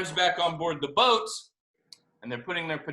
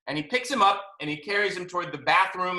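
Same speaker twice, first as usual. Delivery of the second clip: usual speaking voice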